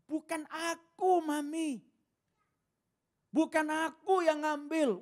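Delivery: put-on high, slow whiny child pleading; innocent child denial. Child denying to Mami, high whiny innocent voice. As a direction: play the wide-eyed kid denying it to Mami.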